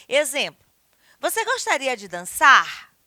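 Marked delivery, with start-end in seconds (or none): 1.2-2.9 emphatic question landing on "dançar"; direct question. Shown as a demonstration. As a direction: ask it loudly, leaning hard on "dançar". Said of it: "É uma interrogação direta".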